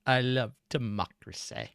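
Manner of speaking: low, slow, soft villainous politician; hushed, deliberate statesman impression; Chancellor Palpatine. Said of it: "to quote Chancellor Palpatine".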